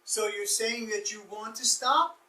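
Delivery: "stop" rises at the end, slow and measured; slow rising question. Shown as a demonstration. Counselor reflecting a client's wish back as a question, slow rise. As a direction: ask it slowly with an upward end. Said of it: "it's really in the form of a question".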